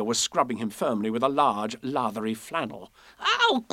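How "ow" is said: high yelp of pain; sharp little ow; squeaky cry. A narrator's reading. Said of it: "cried Piglet".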